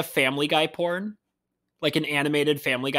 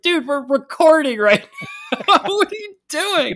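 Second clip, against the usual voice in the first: high, incredulous protest breaking into laughter; mock exasperation; 11 semitones higher, 5 dB louder than usual. Host replies to friend interrupting the recording, mock exasperated. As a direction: pitch it high, stretching the last question. Acted reply to ill-timed video message.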